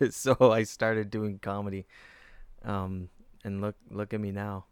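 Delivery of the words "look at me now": ordinary talk, nothing marked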